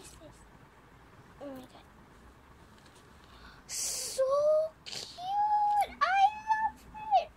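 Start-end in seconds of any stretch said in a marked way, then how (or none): 3.7-7.3 very high, loud squeal of joy; excited squeal. Real, not acted. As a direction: shriek with delight at the gift. Squealing over a box.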